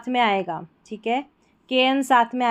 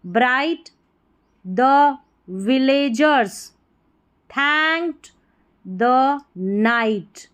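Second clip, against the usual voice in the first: very slow, deliberate dictation with rising phrases; word-by-word reading; 2 semitones higher, much slower than usual. Shown as a demonstration. Teacher reading silent-letter story to learners, slow word by word. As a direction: read each word separately, stretched out.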